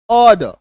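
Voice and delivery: ऑर्डर said loud as "aw-duh"; silent R. Shown as a demonstration. Teacher repeating the model, ऑर्डर without R sounds. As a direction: leave the R's out of ऑर्डर. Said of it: "इसका उच्चारण क्या होता है".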